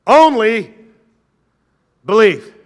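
each word set apart, loud; declared slowly. Shown as a demonstration. Preacher reading the text to the church, loud. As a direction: proclaim it, pausing between words. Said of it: "Read that with me".

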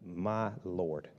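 low, hushed, far-off oh my Lord; dismayed gasp. Retold or imitated. Someone who heard God then lost nerve, hushed dismay. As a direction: sink into sudden dismay. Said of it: "you went".

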